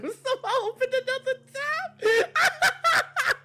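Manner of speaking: very high, loud laughing shriek; drawn-out hysterical cackling words; real laughing squeal. Real, not acted. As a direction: squeal it through helpless laughter. Cracking up over a scare.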